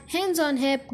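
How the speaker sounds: usual speaking voice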